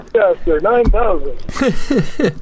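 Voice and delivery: slow, booming announcer voice; hammed-up slow sports announcer; sportscaster voice. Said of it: "Just tell me to put on my sportscaster voice".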